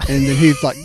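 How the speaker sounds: ordinary talk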